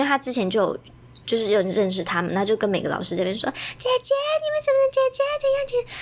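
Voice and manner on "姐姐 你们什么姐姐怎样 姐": put-on high, chattering little girl calling big sister; squeaky child tugging at every teacher; kid voice. Little girl pestering the teachers, high and chirpy.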